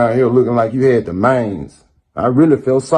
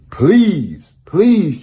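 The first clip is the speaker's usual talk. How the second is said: higher, sarcastic pleading; mock begging; 6 semitones higher than usual. Mocking the rival by pretending to beg to meet him. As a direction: beg like you don't mean it. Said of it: "I'm begging to meet you".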